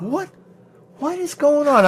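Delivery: high, puzzled what is going on; retelling total bafflement; exasperated confusion. Retold or imitated. Finding only two-second clips, high and baffled.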